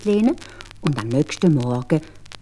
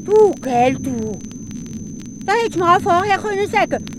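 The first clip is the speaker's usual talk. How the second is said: higher indignant scolding; Hans scolding; 11 semitones higher than usual. Acted. Hans scolds the spirit, higher and indignant. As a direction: tell off the brute.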